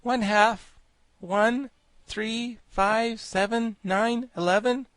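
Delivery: put-on high boyish voice, evenly spaced numbers; boy reciting numbers; Tip counting. A narrator's reading. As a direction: count out one by one, high-pitched. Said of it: "counted Tip".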